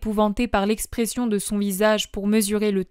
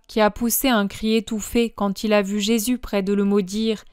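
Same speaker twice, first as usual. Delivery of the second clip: usual speaking voice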